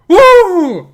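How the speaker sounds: very high, loud cheering woo; high whoop. Acted. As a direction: whoop it up in triumph. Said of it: "Maybe I should have gone for a Mario voice".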